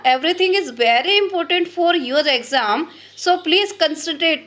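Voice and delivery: every word slow and weighted; slow, stressed plea to concentrate; slow emphatic urging. Real, not acted. Teacher urging students. (धीमे, ज़ोर देकर समझाना)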